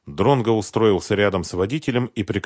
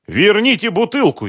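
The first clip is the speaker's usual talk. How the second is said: shrill panicked yell, much higher; hysterical shout; 12 semitones higher, 5 dB louder than usual. Acted. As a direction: scream it hysterically. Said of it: "истерично закричал Концевич".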